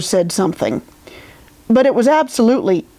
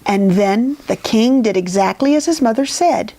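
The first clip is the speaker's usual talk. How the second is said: ordinary delivery, nothing marked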